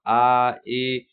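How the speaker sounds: long drawn vowels आ and ई; vowel demo. Shown as a demonstration. Teacher demonstrating vowels to pupils, drawn out. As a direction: sing out आ and ई clearly. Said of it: "मूल स्वर अ".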